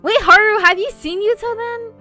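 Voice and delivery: loud, high-pitched question; urgent calling out. Acted. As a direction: call after a friend, high and loud. Calling out to Haru in the story.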